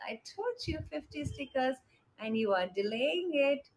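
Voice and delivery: ordinary delivery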